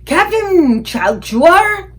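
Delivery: loud, high, slow giant shout. A narrator's reading. Giant shouting to the next catcher, loud and slow. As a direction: draw the name out across the field. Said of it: "he shouted".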